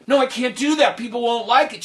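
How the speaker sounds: high, loud alarmed protest; high anxious objection; protesting cook. Acted. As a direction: cook pleading against the order.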